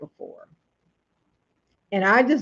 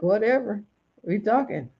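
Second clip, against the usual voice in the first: normal talking voice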